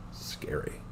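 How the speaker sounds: soft mock-spooky whisper; hushed scary aside. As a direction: breathe it like a ghost story.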